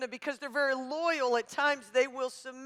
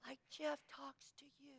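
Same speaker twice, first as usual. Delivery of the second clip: mock soft husband voice; 2 semitones higher, 7 dB quieter than usual. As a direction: imitate him going softer and softer until only breath is left. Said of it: "he gets quieter".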